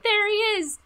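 high, loud cheering cartoon kid voice, slowing on "It's Godzilla"; excited cartoon kids. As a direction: shout it like thrilled kids spotting the monster. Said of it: "the kids going just like".

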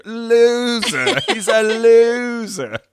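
high, loud, slow taunt; yelling. Retold or imitated. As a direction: yell loser from a passing car. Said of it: "He was yelling that out too".

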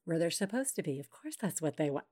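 put-on hushed reassuring tone of a child expert; Teresa voice. Host switches to a calm expert voice about toddlers, soft and soothing. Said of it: "Let me just do my Teresa voice".

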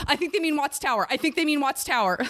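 high, same intonation each time; flat robotic repeat, anxious. Shown as a demonstration. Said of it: "anxious robot voice".

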